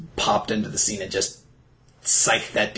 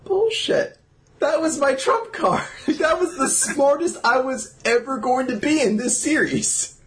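much higher, indignant comic squawk; outraged mock protest; 13 semitones higher than usual. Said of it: "I'm like".

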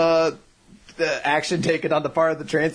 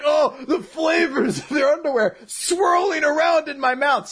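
raised-pitch raving over flavors; mock ecstasy; 6 semitones higher than usual. Groom raving about underwear flavors, mock ecstasy. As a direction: savor it in hammed-up rapture.

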